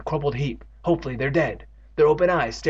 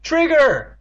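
much higher, louder calling the dog; cry out; 9 dB louder than usual. Acted. Evan calling out to his dog, loud cry. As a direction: shout the dog's name. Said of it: "Evan cried".

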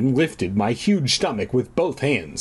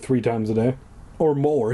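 ordinary talk, nothing marked

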